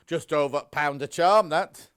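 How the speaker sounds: imitating a colleague in a Yorkshire accent; playful Yorkshire impression. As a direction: put on a Yorkshire voice. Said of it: "I did him with a Yorkshire accent".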